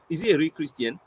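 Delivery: ordinary delivery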